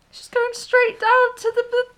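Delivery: very high, loud squeal of disbelief; scandalised shock. Real, not acted. Shocked reaction to the love scene.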